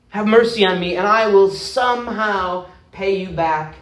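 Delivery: a debtor begging not to be jailed; pleading for mercy. Acted. Friend begs slave for mercy.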